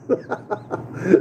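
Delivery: a quick breathy chuckle; short airy laugh. Real, not acted. Amused at the man behind him in line, a quick breathy chuckle. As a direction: laugh under the breath at the joke.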